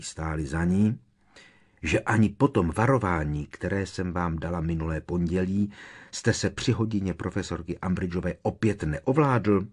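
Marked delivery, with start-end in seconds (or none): none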